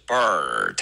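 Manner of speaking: bird drawn out with a curled R; curled-tongue R. Shown as a demonstration. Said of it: "注意了要卷舌".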